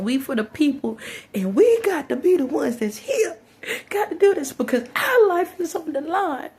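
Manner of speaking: high, loud mock-impassioned speech; hammed-up plea breaking into laughter; mocking, laughing imitation. Vlogger mocks Van's speech for the people, laughing.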